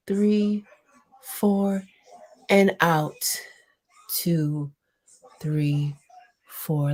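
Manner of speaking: calm counting breath guide; guided breathing. Acted. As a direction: lead the breaths slowly. Leads breathing exercise.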